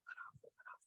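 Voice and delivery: urgent hushed whisper; a hunter whispering to his son to ease his bow. Retold or imitated. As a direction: whisper it so the buck can't hear. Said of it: "I told him".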